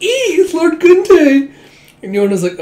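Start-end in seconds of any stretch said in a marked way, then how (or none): 0.0-1.5 put-on very high, slow squealing girly voice; giddy lovestruck girl. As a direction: squeal the name like a smitten fangirl.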